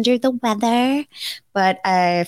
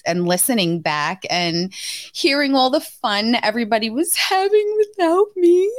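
usual speaking voice

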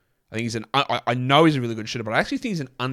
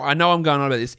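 usual speaking voice

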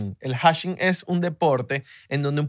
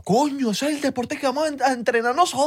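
high, put-on voice of excited uncles; co-host plays Venezuelan uncles discovering a beer sport; mock uncles; 11 semitones higher than usual. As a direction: play the hyped uncle, up high. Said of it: "Imagínate contarle eso a los tíos".

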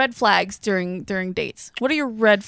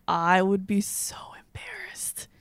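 whispering; 7 dB quieter, slower than usual. Real, not acted. Whispered admission.

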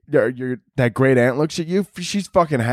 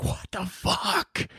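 stunned whisper; 6 semitones higher, 10 dB quieter, much slower than usual. Retold or imitated. Aunt walks in alive.

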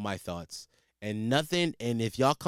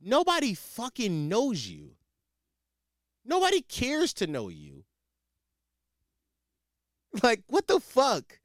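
angry rant; 6 semitones higher than usual. Real, not acted. Ranting at ex-girlfriend.

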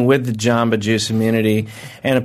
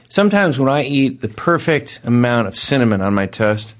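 ordinary talk

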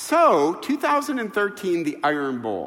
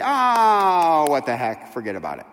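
mock dismissive; 3 semitones lower, 3 dB louder than usual. Imagined God shrugging off his wrath.

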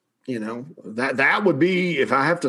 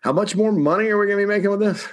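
mock greedy surprise; 8 semitones higher than usual. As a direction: play the school perking up at how much money, a hammed-up double take. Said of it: "they were like".

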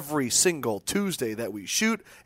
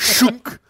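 high, loud mock launcher shunk; playful grenade launcher noise; grenade launcher sound; 9 semitones higher, 6 dB louder than usual.

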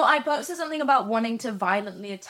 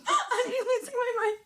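high, helpless laughter through the words; can barely get it out for laughing; laughing hard; 13 semitones higher than usual. Real, not acted. Telling fans about the screeching trains, laughing hard.

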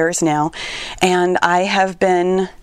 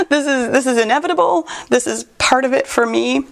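laughing through tears; 6 semitones higher than usual. Real, not acted.

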